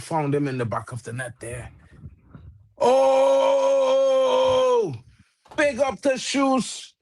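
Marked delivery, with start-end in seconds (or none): 2.8-6.9 high, slow excited call; excited cry. Real, not acted. Streamer watching the game, calling the equaliser. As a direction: sing out the goal news. Goal alert while watching.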